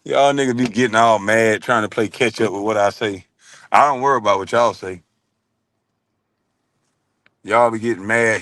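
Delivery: usual speaking voice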